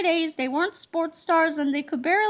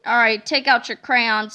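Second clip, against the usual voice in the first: harsh; 6 semitones lower, 4 dB louder than usual. Acted. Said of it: "said Miss Ribble harshly".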